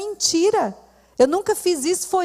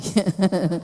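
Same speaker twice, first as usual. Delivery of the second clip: brief real chuckle, low and breathy; short laugh; 9 semitones lower than usual. Real, not acted. Preacher laughing to the congregation about the yellow dress, short chuckle.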